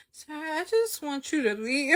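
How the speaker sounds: high-pitched wish to be rid of someone; pleading complaint. Retold or imitated. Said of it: "In my head, I'm like".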